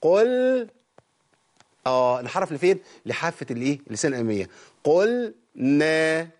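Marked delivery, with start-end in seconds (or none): 0.0-0.7 high, drawn-out قل with tongue to the side; demonstrating the lam. Shown as a demonstration. Teacher showing students the lam sound, high and drawn out. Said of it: "شوف لساني فين؟". 4.8-6.3 قل نا stretched very slowly, every sound held; stretched lam demo. Shown as a demonstration. Teacher demonstrating the lam to the class, very slow. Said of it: "وارجع تاني بلساني للطرف".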